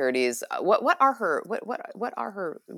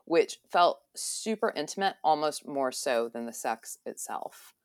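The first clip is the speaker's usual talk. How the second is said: normal talking voice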